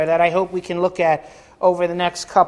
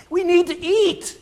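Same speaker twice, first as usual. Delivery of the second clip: Jonathan's plea; 12 semitones higher than usual. Acted. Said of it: "And he's like".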